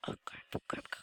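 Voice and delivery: whispered curses; whispered dismay. Real, not acted. Gamer whispering curses under his breath.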